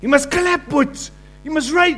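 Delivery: hammed-up rallying shout; pushy worship leader. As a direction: boss the congregation into worshipping.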